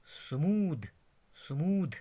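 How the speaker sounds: स्मूद repeated with a voiced 'd' ending; corrected pronunciation. Shown as a demonstration. Teacher repeating for learners, corrected pronunciation. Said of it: "मगर स्मूथ नहीं है".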